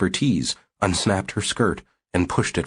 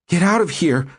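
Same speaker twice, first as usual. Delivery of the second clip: breathless, much higher and louder; panted command; 10 semitones higher, 4 dB louder than usual. Acted. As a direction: pant it at the intruder. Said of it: "Susie panted".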